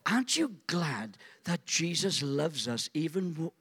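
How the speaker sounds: usual speaking voice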